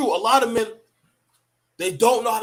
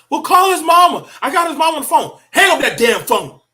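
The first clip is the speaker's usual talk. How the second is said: high, loud mock shouting coworker; mocking women coworkers; 8 semitones higher, 8 dB louder than usual. He mocks women coworkers urging to call mama, high and loud. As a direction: parody the women fussing over him. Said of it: "the other women are like".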